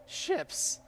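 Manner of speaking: very high, anguished howl; howling creature. Acted. Kobold howling in anguish at the player, very high. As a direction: howl as if in pain. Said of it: "the Clawbotter howls".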